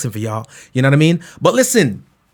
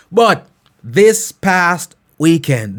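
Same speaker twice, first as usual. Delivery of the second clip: normal talking voice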